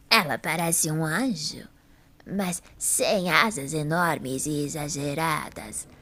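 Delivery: put-on low, slow old woman's voice, hushed at times; an elderly lady recalling the statue, slowly; lightly voiced old woman, unhurried and low; frail old lady. Old Mrs Lee describes statue.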